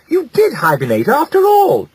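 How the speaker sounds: mother overjoyed her son lives; cried out in joy. A narrator's reading. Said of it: "cried Hilda".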